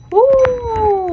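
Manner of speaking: high, loud mock-menacing woo; mock threatening woo. Streamer taunting a game character, mock-menacing woo. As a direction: hoot it out like a playful threat.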